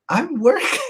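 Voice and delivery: laughing through the words. Real, not acted. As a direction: let the laugh break into it. Declining the party invite, laughing.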